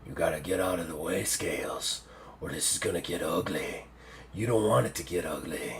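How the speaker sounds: very low, hushed creature voice; menacing octopus. Acted. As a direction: warn them off quietly, deep and soft. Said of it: "He's like".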